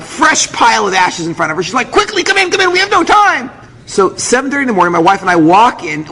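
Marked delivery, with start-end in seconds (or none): 1.9-3.5 high-pitched mock panic; nervous rushing. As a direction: hurry them in like a frantic clerk. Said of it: "nervous type".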